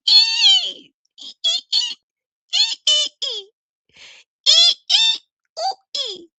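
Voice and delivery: high, squealing ape cries, some breathy and unvoiced; imitating bonobo screeches. Mocking the group by screeching like bonobos. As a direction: screech like a troop of apes chattering. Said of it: "all you can hear is".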